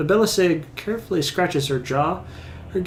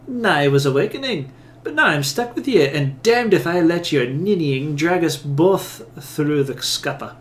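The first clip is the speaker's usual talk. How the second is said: louder Scots-flavoured brogue, trailing down at the end; Isilmir's accent; 5 dB louder than usual. Acted. Awakened soul refuses to be dragged down, forceful Scots-style accent. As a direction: argue back forcefully in an attempted Scots accent. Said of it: "Isilmir's accent".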